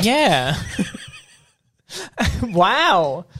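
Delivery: usual speaking voice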